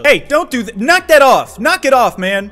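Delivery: loud, high telling-off; hammed-up outraged scolding; indignant scolding. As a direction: yell at them to quit it, rising in pitch. Scolding opponent for smoking in game.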